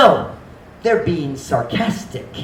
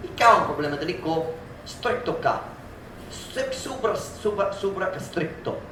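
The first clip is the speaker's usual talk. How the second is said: much softer muttered blame; muttered grievance; 9 dB quieter than usual. Acted. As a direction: grumble the blame under your breath. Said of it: "that attitude towards God".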